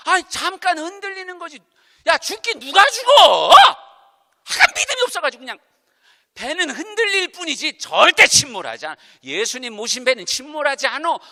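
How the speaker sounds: a hint of a bold deaconess rising to loud rebuke; scolding elder. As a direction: dismiss the panic with confident scorn. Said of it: "우리 권사님이요, 이렇게 말씀하시네요". (권사님이 제자들을 나무라는 말투)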